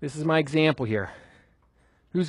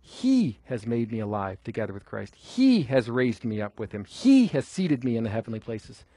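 each "He" lifted, the rest falling low; stress on He; 6 semitones lower than usual. Shown as a demonstration. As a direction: hit "He" at each start, then drop away. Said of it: "I think it should be his".

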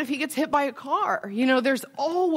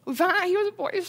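real tearful strain, pitched much higher; choked up; 8 semitones higher, faster than usual. Real, not acted. Mother telling of her first baby's scan, choked up. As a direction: push the words out through rising tears, high and tight. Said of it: "I'm gonna get choked up".

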